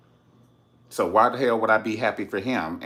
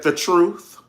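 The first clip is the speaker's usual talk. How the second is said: firm retort; 5 semitones higher, 8 dB louder, slower than usual. Retold or imitated. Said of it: "She said".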